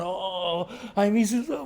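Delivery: high, loud hammed-up wail of longing; mock wailing wife. A devotee mimics a wife wailing for her husband, in a hammed-up high wail. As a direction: wail it like a wife rolling on the ground, mock crying. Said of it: "crying, tears pulling the hair".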